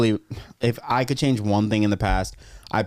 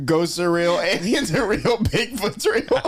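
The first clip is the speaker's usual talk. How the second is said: laughing; 7 semitones higher than usual. Real, not acted. Giddy list, rising with laughter.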